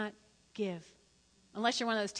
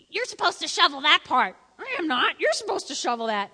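higher, louder mock bickering; squabbling teenagers; 4 semitones higher, 6 dB louder than usual. Siblings arguing over shoveling snow, mock bickering. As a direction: play the kids fighting over chores. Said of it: "acting like teenagers".

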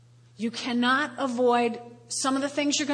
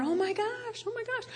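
high, soft oh my gosh; a hint of alarmed sympathy; mock dismay; 8 semitones higher, 7 dB quieter than usual. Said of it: "I'm going".

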